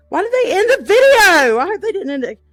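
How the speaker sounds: loud, high wail of protest; dismayed outcry. Real, not acted. Vlogger upset a video stopped, loud dismayed outcry. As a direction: cry out in dismay, voice shooting up.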